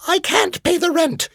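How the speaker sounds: very high falsetto plea; distressed damsel. Retold or imitated. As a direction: squeal it like a helpless heroine. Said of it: "old melodrama played out".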